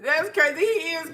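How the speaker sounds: high, slow words through tears of laughter; can barely get it out for laughing; crying with laughter at a joke. Real, not acted. Laughing hard at the comment.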